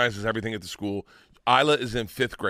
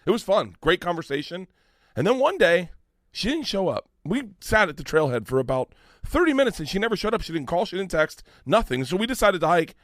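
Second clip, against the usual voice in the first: high-pitched, aggrieved storytelling oratory; reenacted campaign speech; 6 semitones higher than usual. Candidate telling parents about a missed hike, impassioned speech. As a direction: build the grievance like a stump speech. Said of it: "This is almost verbatim in my speech".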